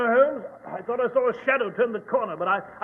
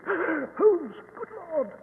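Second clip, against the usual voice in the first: shocked gasp; 6 semitones higher, 7 dB quieter, much slower than usual. Acted. Discovering the dead guard.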